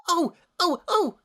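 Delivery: very high, eager squeak; like a kid begging to be picked; bouncy excited squeal. A narrator's reading. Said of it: "Pinky bounced excitedly".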